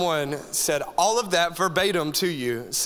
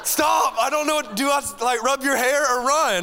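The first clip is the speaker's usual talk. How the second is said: higher-pitched helpless husband; mock panic; 5 semitones higher, 3 dB louder than usual. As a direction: play up the flustered, helpless panic. Mimics helpless husband facing wife's crying.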